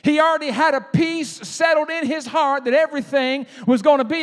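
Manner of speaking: loud, high, drawn-out preacher cadence, words spaced with long pauses; fiery preaching. Acted. Preacher telling his church how Jesus slept through the storm, rising preaching. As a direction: build the room up slowly like a revival preacher.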